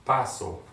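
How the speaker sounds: "paso" with its first syllable stressed; stress second-to-last syllable. Shown as a demonstration. Teacher showing students where the accent falls in "paso", stressed on the first syllable. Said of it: "put the accentuation on the second to the last".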